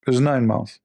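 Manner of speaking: normal talking voice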